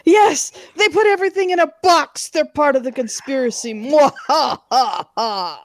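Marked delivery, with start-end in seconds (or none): 4.3-5.6 slow, low villain laugh; drawn-out evil cackle; mad scientist. Pretend. Mocking conspiracy, evil laugh spelt.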